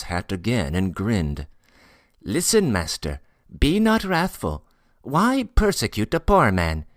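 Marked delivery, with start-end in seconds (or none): 2.2-6.9 pleading Tatar porter. Acted. Porter begging the master not to be wrathful, pleading high. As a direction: implore the master in a raised character voice.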